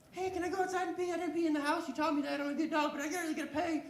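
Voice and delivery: high pleading doggy voice, half laughing; desperate dog. Pretend. Dog begging its owner to let it outside, high and pleading. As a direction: beg to go out, bursting to pee.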